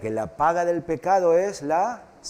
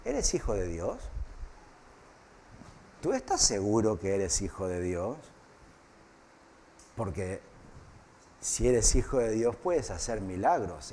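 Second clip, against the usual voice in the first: tempting devil; 7 semitones lower, 8 dB quieter than usual. Retold or imitated. Preacher voices the devil tempting.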